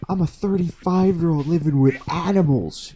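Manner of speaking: high mock outraged yell; hammed-up high-pitched outburst; mock rant. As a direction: imitate the housemate ranting. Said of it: "And he was like".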